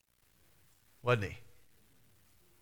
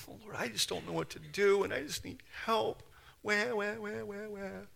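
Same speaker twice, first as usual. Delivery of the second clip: high, soft mock-pitiful whine; whining prayer; 7 semitones higher, 7 dB quieter than usual. Preacher mocking how we go to God, whining. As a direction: whimper it like a helpless crybaby. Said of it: "That's whining".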